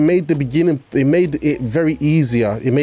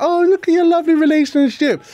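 mocking gushing voice; 11 semitones higher than usual. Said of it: "people are like".

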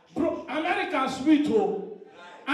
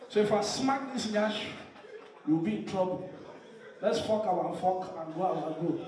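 low, soft, earnest mock warning; subdued, deadpan low plea; sober warning; 5 semitones lower, 6 dB quieter than usual. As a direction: play the weary man warning his lover. His sober warning to the girl.

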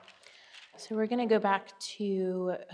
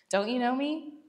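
higher, sassy rising "Don't you know me?"; 5 semitones higher than usual. Shown as a demonstration. Jesus teasing Philip about knowing him, sassy. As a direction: tease him with a cheeky upward lilt. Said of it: "I love how sassy Jesus can be sometimes".

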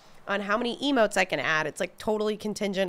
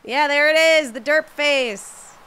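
loud, high, slow derp face; delighted reveal of the emote; excited reveal; 7 semitones higher, 9 dB louder, slower than usual. Real, not acted. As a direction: point it out with glee. Showing her derpy face emote.

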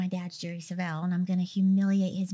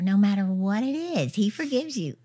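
ordinary delivery

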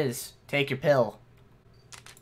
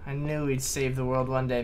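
ordinary delivery

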